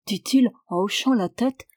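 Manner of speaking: ordinary delivery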